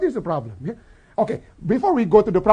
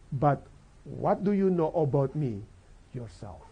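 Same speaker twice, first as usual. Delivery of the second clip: Jesus asking gently; 6 semitones lower, 8 dB quieter than usual. Acted. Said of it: "Jesus would like to know".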